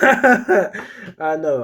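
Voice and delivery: laughing aloud. Real, not acted. Laughing at own bit.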